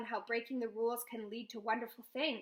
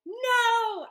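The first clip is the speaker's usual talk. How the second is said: much higher and louder, a full yell; desperate shout; 12 semitones higher, 12 dB louder than usual. A narrator's reading. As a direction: scream it as the house lifts. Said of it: "I shout".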